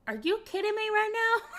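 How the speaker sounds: high-pitched mock outrage; offended. Southerner retelling her reaction to a man's question, offended. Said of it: "I was so offended by this man".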